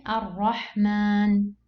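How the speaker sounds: Ar-Rahman slow and firm, last aa held long; long-vowel demonstration. Shown as a demonstration. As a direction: sound the small alif in Ar-Rahman as a full aa. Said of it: "as if the word is written like this".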